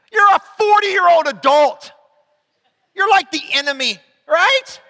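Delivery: a teasing, disbelieving jab; incredulous exasperation. Retold or imitated. Pastor teasing the awkward adult youth worker, incredulous. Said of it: "I'm going".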